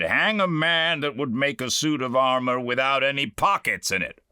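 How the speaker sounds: fed-up knight cursing his pocketless armor; losing his temper. Acted. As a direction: blurt it out in a fit of temper. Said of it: "I lost my temper and said".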